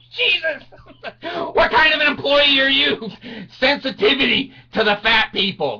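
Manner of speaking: high, loud mock outrage; indignant outburst. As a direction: shout in comic indignation at the ride attendant. Acted outrage at ride attendant.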